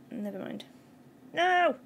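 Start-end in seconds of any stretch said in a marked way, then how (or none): 1.3-1.8 loud, higher cry of no; sudden loud protest; dismayed shout. Real, not acted. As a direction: shout in frustration at a failed move. Fails in the game.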